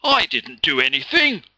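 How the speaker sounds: loud, high indignant bellow; bully bellowing in innocence. A narrator's reading. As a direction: bellow it in protest. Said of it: "Lee bellowed".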